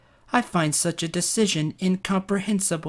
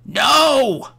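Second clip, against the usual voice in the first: sharp high shout of dismay; loud cry; 10 semitones higher, 5 dB louder than usual. Real, not acted. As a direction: cry out in protest. Character knocked out in game.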